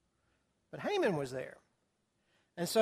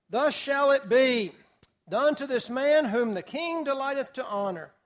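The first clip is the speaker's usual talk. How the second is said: herald's proclamation; 4 semitones higher, 5 dB louder than usual. Acted. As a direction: cry it out like a herald before the crowd. Proclamation quoted in the verse.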